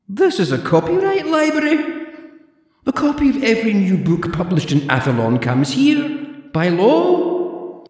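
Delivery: put-on high, prim old librarian voice; haughty zombie librarian, clipped and proper; librarian Deirdre. Deirdre lectures the borrower in a high prim voice.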